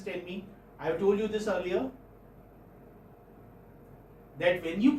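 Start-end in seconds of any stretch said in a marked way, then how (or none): none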